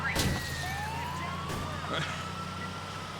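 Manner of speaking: laughing. Real, not acted. Laughing at the cops.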